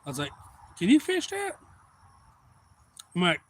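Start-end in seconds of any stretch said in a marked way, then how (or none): 0.8-1.6 pitched way up, mock asking; acted eager question. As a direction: ask it in a much higher voice. Acted question to lake owner.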